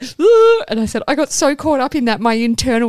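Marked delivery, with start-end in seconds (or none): 0.0-0.6 loud squealing ugh; pitched-up groan at the awkwardness; high loud groan of dread. Retold or imitated. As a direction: let out a high anguished ugh. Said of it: "I was like".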